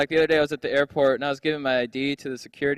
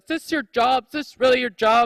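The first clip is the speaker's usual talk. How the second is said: mock snooty lady; 9 semitones higher, 5 dB louder than usual. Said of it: "She's like".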